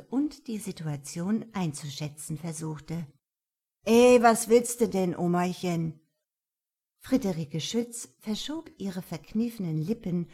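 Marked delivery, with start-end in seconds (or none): 3.8-6.0 put-on cocky, loud street kid; rude teen voice. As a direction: taunt her like a brash punk. Teen goth taunts old woman.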